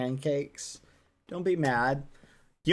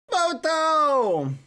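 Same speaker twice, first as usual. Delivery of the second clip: high loud call; 9 semitones higher, 5 dB louder than usual. Real, not acted. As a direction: shout it to the group. Calling for photo.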